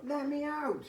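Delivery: high, loud, drawn-out call from below; young son calling through a door; young man calling out. Acted. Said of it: "young male voice again calling out".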